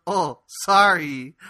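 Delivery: slow, drawn-out comic apology; imitated grandmother owning up. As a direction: stretch it out as a mock-sheepish admission. Grandmother owns up to smell.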